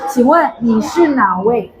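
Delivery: slow, respectful question; polite. Shown as a demonstration. Teacher repeating the polite phone question, slow. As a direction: ask it slowly and courteously. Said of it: "Itu sangat sopan".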